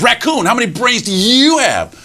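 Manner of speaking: high, swooping challenge on YOU; boastful fox. Acted. Fox challenges raccoon about brains, boastful. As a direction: call out raccoon, then quiz him grandly. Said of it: "and he says".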